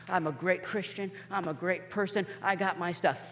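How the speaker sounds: soft, lightly voiced self-satisfied boast; mock boast. Mocking a boastful believer.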